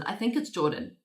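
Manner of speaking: normal talking voice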